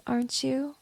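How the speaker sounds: ordinary talk, nothing marked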